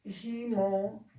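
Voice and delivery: हीमोग्लोबिन as a careful rising model word, "ही" with a long I; model pronunciation. Shown as a demonstration. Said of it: "और इसे पढ़ना है हीमो".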